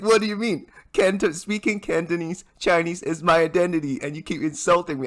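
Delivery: high-pitched indignant protest, replaying the insult; high, indignant retort to a teasing friend; indignant outburst. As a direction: push back hard, voice jumping up. Retold indignant reply to friend.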